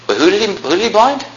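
high, mock-puzzled question; leading question. Preacher quizzing the congregation on the verse, mock-puzzled.

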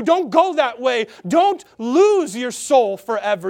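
high, urgent pleading cry to turn back; light screaming out. Acted. God's light crying out to sinners on the highway, a high urgent plea. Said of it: "this light screams out".

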